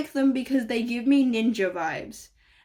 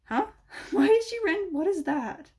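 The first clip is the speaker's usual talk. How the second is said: surprised; 10 semitones higher than usual. Real, not acted. Spots something odd on the model.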